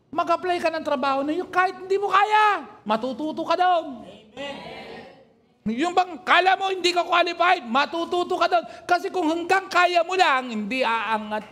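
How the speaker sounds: fervent preacher; preaching. Real, not acted. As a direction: drive the point home. Preaching at full force.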